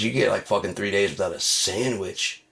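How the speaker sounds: normal talking voice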